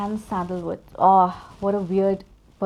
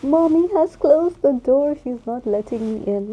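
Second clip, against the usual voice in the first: voicing the cat; 5 semitones higher, 7 dB louder than usual. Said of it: "he was like".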